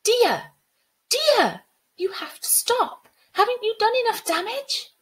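high, loud, pleading yell; desperate shout. Acted. Girl confronting the water spirit over the flood, high desperate shout. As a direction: cry out in angry desperation.

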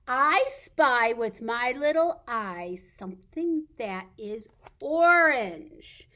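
loud, slow, stretched picture-book reading; reading to children. Pretend. Reading an I spy riddle to toddlers, playful read-aloud. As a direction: read it big and drawn out for toddlers.

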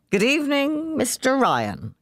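high, overly formal greeting; bow with the words, drunkenly polite; ceremonious. A narrator's reading. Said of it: "he added ceremoniously".

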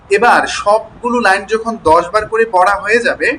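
ordinary talk

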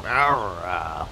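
low rough growling rawr; two sinking low growls; pirate growl. Shown as a demonstration. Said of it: "like a growl".

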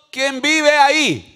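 loud, high call through a door. Acted. Caller at the believer's door, calling out loudly. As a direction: call out slowly to whoever is inside.

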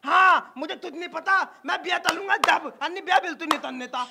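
lisping son stubbornly reeling off demands; childish lisp. Acted. Groom lists his dowry demands, lisping. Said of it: "ये तो तोतला है".

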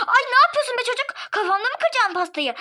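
high, fast, loud scold; alarmed scolding. Acted. Guest scolds the child about to throw cake, alarmed.

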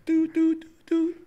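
high sung beeps; dial tone. Retold or imitated. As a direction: imitate a hang-up tone. Imitating hang-up tone.

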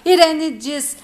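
ordinary delivery

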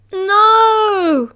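put-on high, drawn-out child's protest; a frightened little boy refusing.

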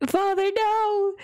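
high, drawn-out mock cry of dismay; hammed-up child pleading, slow and high; mock dramatic plea. Playing a child crying out to father, mock dramatic.

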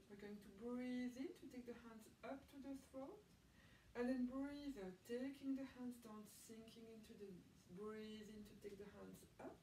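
calm, even yoga teacher voice; guided breathing instruction. Acted. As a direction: talk students gently through each breath.

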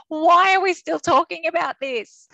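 high, loud, slow weary plea; exasperated. Retold or imitated. Manager fed up with the coach arguing till 1 AM, exasperated. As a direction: sound fed up and worn out.

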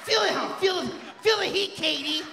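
high, loud hammed-up urging. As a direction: cheer her on like a frat boy. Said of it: "You make little noises".

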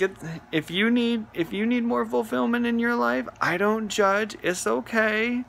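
mock-gentle no-judgment voice; mock reassurance. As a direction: pretend to comfort him kindly.

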